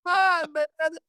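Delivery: high, near-crying mock dismay; dismayed panic. Promo man reacts to being insulted, high crying dismay. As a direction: whimper it like the worst insult ever. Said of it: "I was like".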